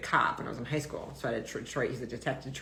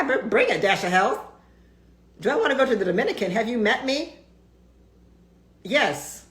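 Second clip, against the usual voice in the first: excited; 6 semitones higher, 8 dB louder than usual. Real, not acted. Excited about a Dominican retreat.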